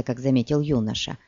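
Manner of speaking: plain reading voice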